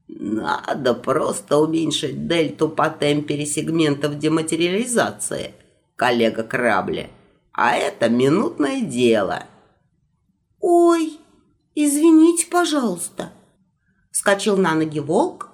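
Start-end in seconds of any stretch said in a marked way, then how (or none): 0.1-9.4 put-on old magister voice. Second magister replies. 10.6-13.3 high, loud startled yelp of sorry; flustered apology. Acted.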